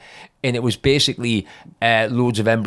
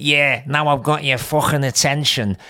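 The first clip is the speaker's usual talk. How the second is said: slightly raised, rough attempt at Scouse; triumphant mock Liverpool accent, loosely imitated; Anvil's Scouse accent; 4 semitones higher than usual. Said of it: "I can't do the accent".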